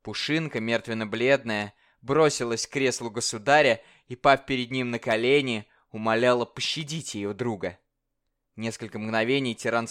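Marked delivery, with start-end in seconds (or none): none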